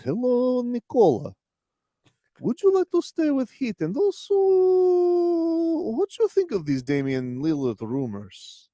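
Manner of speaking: put-on high, slow, accented interviewer voice; Christos impression. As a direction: draw out questions in a high foreign accent. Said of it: "Christos, you know, decided to drop that interview".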